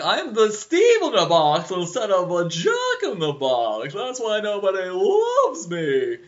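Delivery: put-on slow high old-man character voice; Ed Wynn voice. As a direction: wobble through it like the old toy guide. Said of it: "he's like".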